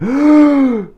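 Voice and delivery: loud-drawn high gasp for air, imitated; mock gasp of someone about to pass out; desperate gasp. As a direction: suck in air after a breathless pitch. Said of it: "he went".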